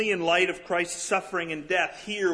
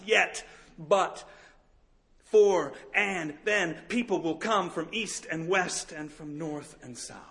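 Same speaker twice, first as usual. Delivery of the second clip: slow deliberate build; 2 semitones higher than usual. Real, not acted. Preached refrain, slowed.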